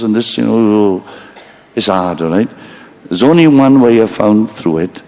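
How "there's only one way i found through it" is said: ordinary delivery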